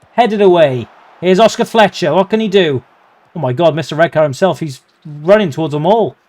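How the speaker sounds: ordinary delivery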